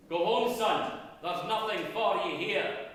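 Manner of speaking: a clan chief turning the lad away, loudly; stern chieftain warning. Acted. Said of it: "The chieftain said to the young laddie".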